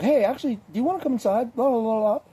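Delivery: high, sugary invitation; possessed girl luring him inside, high and sweet. Retold or imitated. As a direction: coo it high like a smitten girl. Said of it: "she's like".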